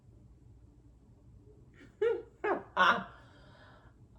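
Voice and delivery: loud high laugh, breathy and unvoiced; loud burst of laughter. Real, not acted. Vlogger laughing at her own sock joke, loud breathy laughter.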